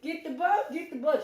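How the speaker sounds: mother and son cling together urging the bug be killed; frantic pleading. Retold or imitated. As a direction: beg someone to kill the bug, fast. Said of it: "we both holding on each other like".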